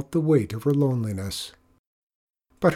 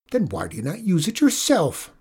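higher, lightly mocking question; 6 semitones higher than usual. Griselda scoffing at the magic kerchief, mild mockery. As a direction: needle the old woman with scorn. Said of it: "mocked Griselda".

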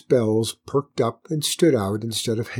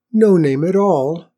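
loud, higher shocked exclamation; scandalised and raised in pitch; 6 semitones higher, 8 dB louder than usual. A narrator's reading. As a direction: react with startled disbelief. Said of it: "said the hare, shocked".

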